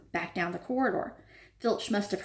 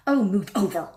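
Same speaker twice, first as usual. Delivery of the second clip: louder impatient snarl; 6 dB louder than usual. A narrator's reading. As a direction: snap at him to move aside. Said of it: "Hermione snarled".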